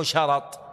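"شرط" with a short a on the ر; fatha on ra. Shown as a demonstration. Teacher explains a plural's singular, "شرط" voweled sharat. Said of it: "بفتح رائه".

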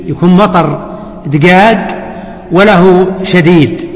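normal talking voice

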